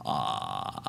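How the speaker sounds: very low creaky held "ah"; soft, rattling fry sound; vocal fry. Shown as a demonstration. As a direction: let the voice drop into a long low fry. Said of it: "if you try to make a grudge sound".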